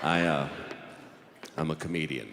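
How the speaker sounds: very low, halting confession; sheepish admission. Acted. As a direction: drop the voice and admit it reluctantly. Reluctant confession to judge.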